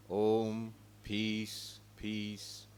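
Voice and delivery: ordinary talk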